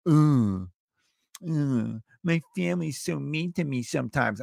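mock whine, groaning ugh; whiny complainer. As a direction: whine like a sulky adult child. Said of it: "there you are being".

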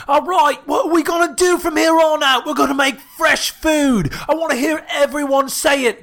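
high, loud, shouted British chef impression; Gordon Ramsay. Chef rallies the kitchen staff, shouted impression. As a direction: bellow like a fired-up TV chef rallying staff. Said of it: "where Gordon Ramsay's like".

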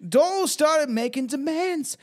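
high, slow, put-on British accent. Reader voicing Shirley's account of Donald, put-on British accent. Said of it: "I got the British".

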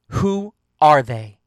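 loud, slow, controlled anger; angry. A narrator's reading. Paris asks who they are, controlled anger. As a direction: demand it loudly, drawing out the words. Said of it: "Paris sounded angry, but not at me".

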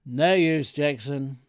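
loud call across to a colleague; shouted call. A narrator's reading. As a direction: holler it to the other shop man. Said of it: "my chap shouted at him".

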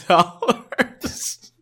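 bursting into a high laugh on the word; the amount cracks him up mid-word. Real, not acted. Podcast host cracking up over thirty-five million, laughing the word.

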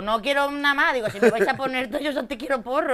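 high, loud and emphatic; loud retold insistence; insistent demand. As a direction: insist on the one thing she wants. Insisting to the producers.

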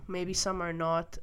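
ordinary talk, nothing marked

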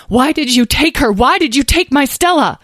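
loud, high, anguished demand; unable to stay calm. A narrator's reading. Dragon mother confronts woman who knew her child, losing her calm. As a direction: cry out the questions, losing control. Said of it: "she was unable to speak calmly".

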